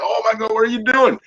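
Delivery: high-pitched alarmed outcry; retold panic. Retold panic during back drilling.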